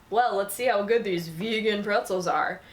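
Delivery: put-on voice imitating a dubious snacker; mock skeptical. Snacker doubting an offered pretzel, mock skeptical. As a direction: lean mockingly into the quoted doubt. Mimics snide response in read meme.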